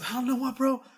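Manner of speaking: high-pitched, baffled imitation of a performer; acted-out wonder at a sudden cure; amazed retelling.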